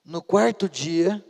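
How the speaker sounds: normal talking voice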